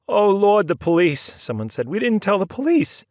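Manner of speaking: high, loud alarmed exclamation; startled cry. A narrator's reading. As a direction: gasp it out in alarm. Family startled by police.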